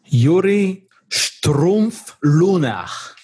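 slow, drawn-out attempt at the name in a hammed-up German accent; mock German accent. Host introduces the guest, trying his name in mock German accent. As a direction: stretch the German name out slowly in a playful German accent. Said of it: "My German accent, not so much".

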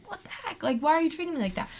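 mock shocked what-the-heck; retold indignant surprise. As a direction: blurt it out, caught off guard. Retold shock at being mistreated.

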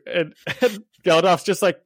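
ordinary delivery

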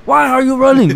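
high, loud imitation of an angry African man; harsh African accent. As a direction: yell it hard in a mock African accent. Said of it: "the way he says it, it's just so hard".

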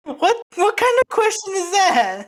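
high, loud, drawn-out disbelief; incredulous. Real, not acted. Friend balking at an accent request, incredulous. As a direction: stretch it out, baffled by the request. Asked to do Spanish accent, balks.

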